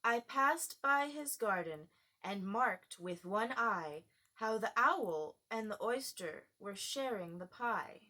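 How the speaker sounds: usual speaking voice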